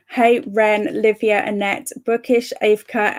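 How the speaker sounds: ordinary talk, nothing marked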